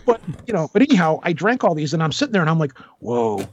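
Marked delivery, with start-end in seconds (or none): 3.0-3.4 low, slurring tipsy inner voice; getting drunker. Retold or imitated. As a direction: sound like the bourbon is kicking in. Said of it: "I'm getting really toasted".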